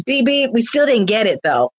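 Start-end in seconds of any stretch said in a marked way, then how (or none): 0.0-0.5 cartoon beep beep. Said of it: "I was like Speedy Gonzalez".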